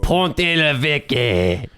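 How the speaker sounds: very slow, drawn-out fake French; mock French accent. Reading French cheese names for jokes, drawn-out mock French accent. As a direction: stretch the cheese name in hammy French. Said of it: "names of some obscure French cheeses".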